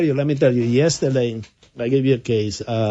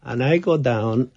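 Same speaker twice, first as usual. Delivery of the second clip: usual speaking voice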